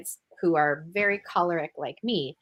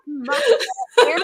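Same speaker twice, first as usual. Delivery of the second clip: laughing; 6 dB louder, much faster than usual. Real, not acted. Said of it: "direct quote".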